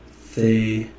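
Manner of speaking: normal talking voice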